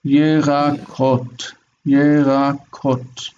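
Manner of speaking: Yerakot drawn out slowly as ye-ra-KOT; slow, last syllable stressed. Shown as a demonstration. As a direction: sound out Yerakot slowly, leaning on the final kot. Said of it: "accent is on the last syllable".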